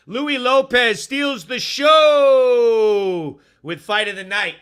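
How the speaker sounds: loud, slow announcer boom; headline announcer. Acted. Host reading a funny fight headline to viewers as a booming announcer.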